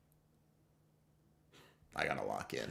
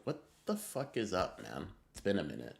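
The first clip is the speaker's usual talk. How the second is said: ordinary talk, nothing marked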